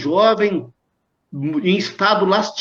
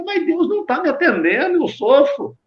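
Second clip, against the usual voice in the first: higher-pitched, lightly acted lament; plaintive complaint; 9 semitones higher than usual. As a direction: voice a suffering woman's mild protest. Voicing a suffering woman's complaint. (lamento agudo, levemente encenado)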